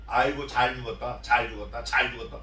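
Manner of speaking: low mock good-riddance; imitated dismissive remark. Mourners who won't wail for the dead king, low mock dismissal. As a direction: drop it low, as if no mourning is due. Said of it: "이렇게 한다는 거예요".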